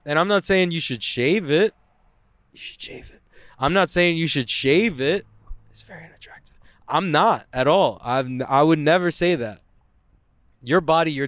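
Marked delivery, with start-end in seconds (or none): 2.5-3.2 a sly whispered aside slipped in under the breath. Acted. Joking about armpit hair, whispered sneaky aside. 5.7-6.5 a sly whispered aside slipped in under the breath. Acted. Joking about armpit hair, whispered sneaky aside.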